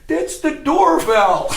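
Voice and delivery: slow, squeaky words choked with laughter; laughing through it. Retold or imitated. Wife can't stop laughing and points at the alarm, giggling. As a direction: barely get it out for laughing, then burst.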